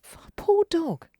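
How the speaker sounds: slow pitying whisper; a dog lover whispering sympathy for the pet. Real, not acted. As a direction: breathe it out with pity. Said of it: "pitying whisper".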